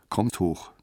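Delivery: plain reading voice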